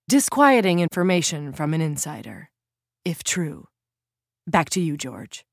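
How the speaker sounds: TV reporter wrap-up; reporter sign-off. Acted. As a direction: sign off to the anchor. Reporter signing off.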